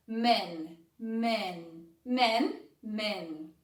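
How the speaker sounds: men said flatter, without a smile, mouth relaxed; serious men. Shown as a demonstration. Teacher shows men with a straight, serious face. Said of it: "fala um pouco mais sério".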